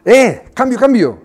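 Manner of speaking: loud call from the bench, cambio cambio; shouting for a sub. Retold or imitated. Coach yells to his goalkeeper to come off, loud call. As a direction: yell at the keeper to come off. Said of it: "Y yo le gritaba".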